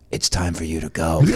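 low, restrained giant's voice; huge intimidating man. Retold or imitated. Shrek-sized officer to drunk heckler, low and firm. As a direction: drop it low, a quiet order. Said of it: "it was Shrek".

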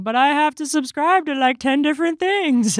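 high-pitched whiny customer complaint; exaggerated high-voiced griping; mock whining. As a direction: imitate a put-upon subscriber moaning.

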